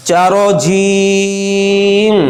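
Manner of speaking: "जीम" stretched long on one level note; drawn-out letter name. Shown as a demonstration. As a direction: hold the ee in "जीम" slowly before closing on m. Teacher chants letter name in summary.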